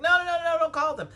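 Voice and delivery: high, loud panicked begging; frantic pleading. Retold or imitated. Begging Shaquita not to call the ambulance, high panicked plea. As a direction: stop them calling in a rush.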